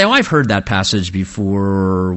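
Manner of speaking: low, uninterested mock grumble; bored listener. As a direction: sound like you've tuned out the sermon. Imagined bored listener during sermon.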